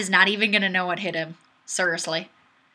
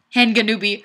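usual speaking voice

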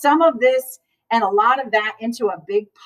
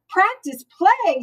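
puzzled; 8 semitones higher, slower than usual. A narrator's reading. Amelia Bedelia puzzled by word.

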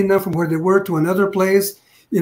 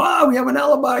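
mocking the smug attorneys, pitched higher; smug mockery; 6 semitones higher, faster than usual. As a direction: imitate their self-satisfied tone. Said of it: "they were looking kinda smug".

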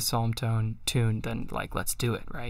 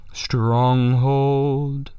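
Stronghold sung slowly with the accent held; 4 dB louder than usual. Shown as a demonstration. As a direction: lean into Stronghold. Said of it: "So this is the accent".

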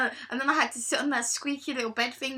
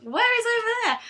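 confused retort; 10 semitones higher, 5 dB louder than usual. Retold or imitated. Retold confused question to staff.

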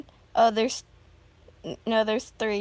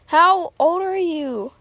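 loud; 6 semitones higher, 11 dB louder than usual. Real, not acted. Loud question to chat.

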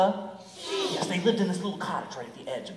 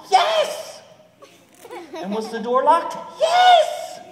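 very high squeaky yes; tiny sheep voice; 12 dB louder, much slower than usual. Acted.

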